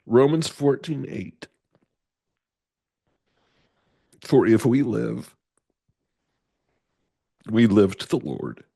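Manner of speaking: loud, earnest Bible reading; scripture reading. Acted. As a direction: proclaim the verses with conviction. Reads Bible verses aloud.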